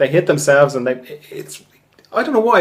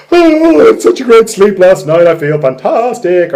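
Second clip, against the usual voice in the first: higher, louder, gushing about a great sleep; delighted morning voice; 5 semitones higher, 6 dB louder than usual. Pretend. A refreshed sleeper greeting the morning, gushing. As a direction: sing out how fantastic you feel, much higher and louder. Voicing someone waking up refreshed.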